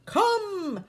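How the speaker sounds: high, loud howled command; desperate trainer howling come. Acted. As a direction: call the dogs back with a howl. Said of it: "howled the leader".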